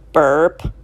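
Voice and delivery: low loud burp; a deep loud ostrich burp. A narrator's reading. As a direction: belch it out. Ostrich burps.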